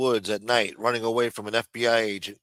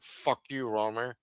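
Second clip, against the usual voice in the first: put-on Chicago accent; 7 dB quieter, slower than usual. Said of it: "with that Chicago accent".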